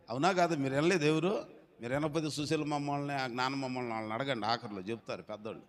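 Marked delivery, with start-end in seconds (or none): none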